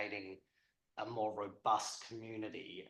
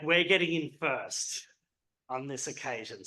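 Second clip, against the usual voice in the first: louder, higher mock-insistent retort; retold jokey exclamation; 4 semitones higher, 9 dB louder than usual. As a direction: blurt it out for a laugh, easing off at the end. Retold jokey retort amid laughter.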